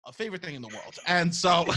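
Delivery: ordinary talk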